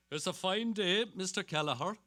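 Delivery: a beat policeman calling out a cheery hello; constable's greeting. Acted.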